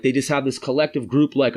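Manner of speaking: ordinary talk, nothing marked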